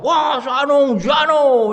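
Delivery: a hammed-up harsh preacher calling out names; mock shout.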